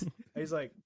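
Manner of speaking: ordinary delivery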